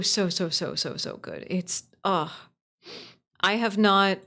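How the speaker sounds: ordinary talk, nothing marked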